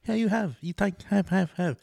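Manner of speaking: high-pitched imitation of broken Vietnamese English. Dad urging his son to eat, high mock broken English. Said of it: "his English is real broken".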